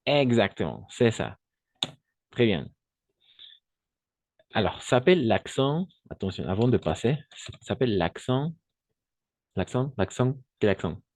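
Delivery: normal talking voice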